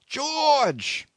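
long, high-pitched call; calling up a shaft. A narrator's reading. Men in the boat call up to their shipmate, crying out. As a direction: cry the name out as if up a shaft. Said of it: "a voice as if crying up a shaft called out".

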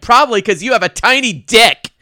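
high, loud mock retort; exasperated outburst. Podcaster voicing Rose snapping back at Ismay, mock outburst. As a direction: blurt it out as an exaggerated comeback. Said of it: "And Rose is like".

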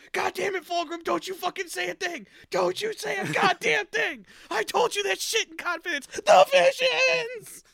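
high, loud angry outburst; hiss-free raging at a gossiping brother; furious yelling. Retold or imitated. Curze yelling at Fulgrim.

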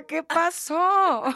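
slow, high, stretched disbelief; teasing about the mother's fresh face; mock disbelief. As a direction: draw out the puzzled question. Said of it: "la cara de parturienta".

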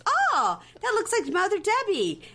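high-pitched, excited mimicry; Annie's exclamation. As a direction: squeal it as an imitated reaction. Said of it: "Annie goes".

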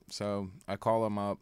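usual speaking voice

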